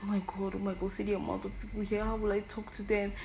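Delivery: ordinary delivery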